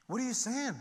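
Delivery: high, amazed question; stunned disbelief. Retold or imitated. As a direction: gasp it out in astonishment.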